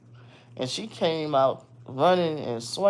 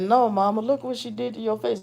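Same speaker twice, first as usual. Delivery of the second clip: put-on higher, distressed little boy; upset child's voice; 8 semitones higher than usual. Scene: son upset at his mother's injured face, higher child voice. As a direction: plead it like a worried son.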